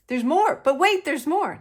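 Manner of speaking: loud, punchy TV pitchman; infomercial voice. Pretend. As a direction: sell it like a late-night ad host. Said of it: "Now I sound like an infomercial".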